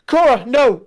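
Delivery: high, loud desperate cry; anguished scream. Acted. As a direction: scream her name as she falls. Enola screams for fallen friend.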